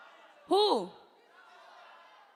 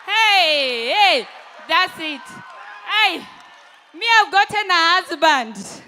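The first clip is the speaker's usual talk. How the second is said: loud, high triumphant cheering; excited shout; 6 semitones higher, 10 dB louder than usual. Real, not acted. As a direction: whoop it out in triumph. Celebrating getting a husband.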